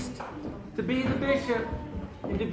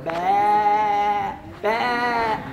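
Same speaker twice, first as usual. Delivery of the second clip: long, held sheep bleat; mama lamb bleat. Retold or imitated. Mother sheep calling for her missing lamb, bleating. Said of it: "except for that mama lamb going".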